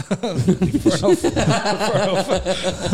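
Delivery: slow, laughing drawl. Real, not acted. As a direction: laugh it out slowly. Laughing drawn out.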